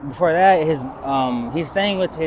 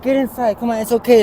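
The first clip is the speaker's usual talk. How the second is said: higher, louder coaxing mom; insistent mother-in-law; 8 semitones higher, 8 dB louder than usual. Retold or imitated. Mother-in-law calling him inside, high and coaxing. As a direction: call him in like a worried mother. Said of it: "she's like".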